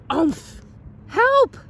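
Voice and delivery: high squealing cry for help; panicked cry. A narrator's reading. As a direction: yelp in a panic. Pig lands on him.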